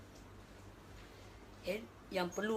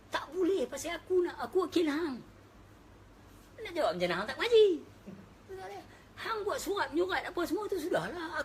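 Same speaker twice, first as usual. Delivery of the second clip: high, loud, fast scolding; exasperated lawyer; 9 semitones higher, 5 dB louder than usual. Retold or imitated. Lawyer berating his client who never studied, loud and exasperated. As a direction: snap at the client.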